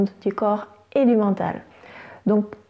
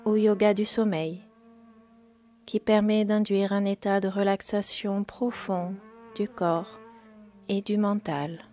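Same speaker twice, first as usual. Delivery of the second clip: guided meditation; 9 dB quieter than usual. Acted. Yoga teacher guiding listeners into yoga nidra, calm meditation voice.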